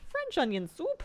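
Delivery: "French onion soup?" jumps up high in delight; excited question. Shown as a demonstration. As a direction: pitch "French onion soup?" high with a bright rising end.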